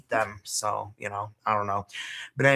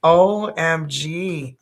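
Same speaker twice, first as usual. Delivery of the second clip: slow O M G, each letter stretched; slow, louder, spelled-out OMG; drawn out; 3 semitones higher, 6 dB louder, much slower than usual. Real, not acted. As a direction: stretch it out in disbelief. Drawn-out amazed exclamation.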